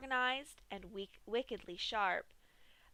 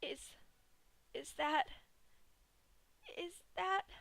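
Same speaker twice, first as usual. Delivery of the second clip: shocked stammer; 8 semitones higher than usual. A narrator's reading. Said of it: "gaped in shock".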